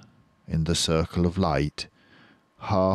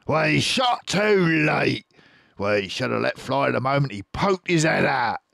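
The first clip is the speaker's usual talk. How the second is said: Boggis grumbling; 8 semitones higher, 5 dB louder than usual. Acted. Boggis blaming the late shot, loud and grumbling. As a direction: complain in Boggis's voice. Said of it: "said Boggis".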